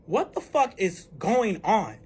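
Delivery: slow, drawn-out disbelief; exasperated, spaced-out question; bewildered exasperation. Retold or imitated. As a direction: stretch each word in bafflement. Retold bewilderment after he leaves.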